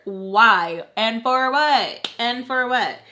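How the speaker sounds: loud, exasperated questioning; so mad. Retold or imitated. Daughter mad that mom called her, exasperated. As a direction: demand a reason, hitting each question hard. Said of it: "so mad because".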